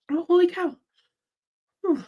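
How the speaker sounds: high, strained exclamation after coughing; real cough-strained voice; choked by coughing. Real, not acted. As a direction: gasp it out after a coughing fit. Recovering from coughing fit.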